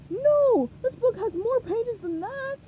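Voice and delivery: outraged seagull; seagull cry. Acted. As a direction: squawk it high and loud. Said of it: "they cried".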